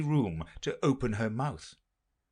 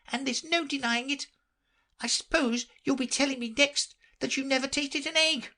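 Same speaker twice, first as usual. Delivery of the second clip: very high, fussy bird voice; fretful pigeon. Acted. The pigeon grumbling about serpents, very high fussy voice. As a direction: complain like a harried mother bird.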